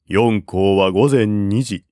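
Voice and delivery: plain reading voice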